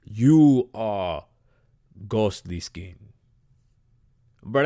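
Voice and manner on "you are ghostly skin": low, halting mock-solemn pronouncement; hammed-up solemn naming, sinking in pitch. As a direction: intone it slowly and low, pausing between phrases. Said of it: "they're like".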